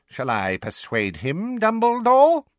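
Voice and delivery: high, slow menacing witch; stern witch. A narrator's reading. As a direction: offer the threat sweetly. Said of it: "called the gimlet-eyed witch".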